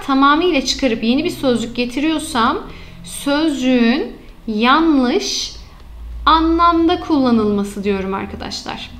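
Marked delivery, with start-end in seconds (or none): 3.0-7.8 slow dictation. Shown as a demonstration. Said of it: "teacher dictates the error type".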